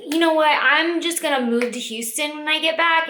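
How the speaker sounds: hyped-up self talk; determined decision. Retold or imitated. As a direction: announce the plan fired up. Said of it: "I was like".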